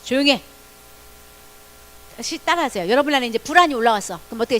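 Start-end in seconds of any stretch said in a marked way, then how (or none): 0.0-0.4 a firm hush order aimed at yourself; firm command. Shown as a demonstration. Speaking to yourself when anxiety rises, firm command. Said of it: "자기 자신을 향해서".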